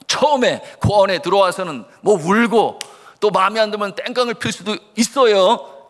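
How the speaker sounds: ordinary delivery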